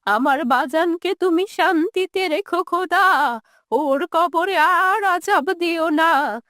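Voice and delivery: tearful, drawn-out plea to God; grieving old woman praying; forgiving old mother. Acted. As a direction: plead for your son in a quavering old voice.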